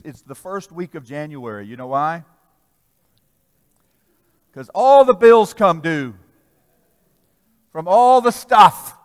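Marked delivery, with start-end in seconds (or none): 4.5-9.0 full-force preaching. Real, not acted. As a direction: preach it hard to the congregation.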